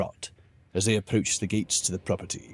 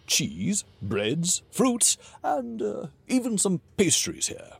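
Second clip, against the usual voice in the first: jovial king voice; 7 semitones higher than usual. Acted. King offers the food.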